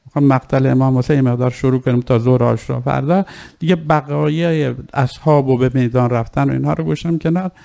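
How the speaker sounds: normal talking voice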